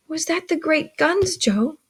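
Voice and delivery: put-on high-pitched questioning boy; curious child. A narrator's reading. Young Pip asks Joe about guns, curious. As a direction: ask it like an inquisitive child.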